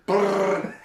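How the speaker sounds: loud, high rolled "brrr"; imitated gun noise. Teacher mimics boy firing the gift shop gun, rattling noise. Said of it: "and you went".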